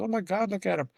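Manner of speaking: lightly acted, high-pitched delight; retold gushing. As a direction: mimic a smitten dog lover. Wife gushing over the puppy's father.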